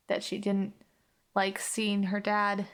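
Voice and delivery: ordinary delivery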